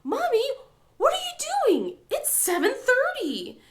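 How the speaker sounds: high, loud imitation of an indignant kid; indignant child. Daughter scolding mom for sleeping in, indignant. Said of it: "acted so indignant about the fact".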